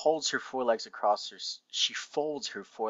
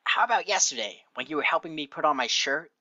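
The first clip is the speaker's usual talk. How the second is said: higher, insistent pony voice; pointed, pitched-up challenge; Rainbow making her case; 5 semitones higher, 4 dB louder than usual. Acted. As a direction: press the accusation in a raised character voice.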